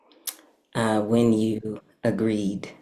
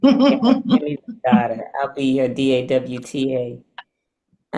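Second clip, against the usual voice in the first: put-on Southern accent; 2 semitones higher, 6 dB louder, much slower than usual. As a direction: ham up the Southern accent for Mama. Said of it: "this Southern accent".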